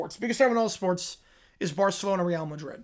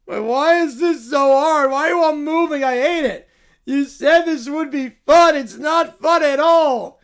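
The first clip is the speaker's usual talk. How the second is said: put-on spoiled bratty kid; 6 semitones higher, 10 dB louder than usual. Spoiled kid complaining to band members, indignant whine. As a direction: demand room like petty royalty.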